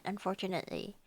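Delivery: normal talking voice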